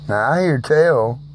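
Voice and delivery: ordinary talk, nothing marked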